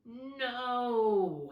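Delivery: loud, high wailing no; howling no. A narrator's reading. Bear refuses bedtime, loud howling protest. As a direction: wail it like a cub at bedtime. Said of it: "howled Bear".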